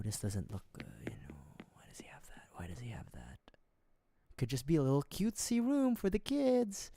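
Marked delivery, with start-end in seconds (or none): 0.0-3.4 hushed whisper to the chat; softer, secretive whispering aside. Real, not acted. Tells chat, out of the villager's earshot, in a whisper. Sneaking through a villager's house.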